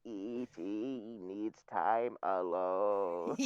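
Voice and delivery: low, very slow synthesized voice; robot voice. Retold or imitated. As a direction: speak like a computer voice box. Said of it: "we're doing Stephen Hawking now".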